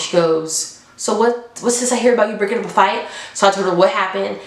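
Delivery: usual speaking voice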